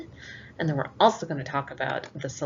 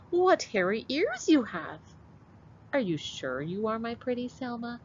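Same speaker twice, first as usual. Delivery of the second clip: Granny voice; 6 semitones higher than usual. Acted. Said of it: "said Granny".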